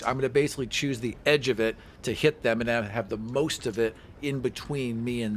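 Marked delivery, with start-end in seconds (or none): none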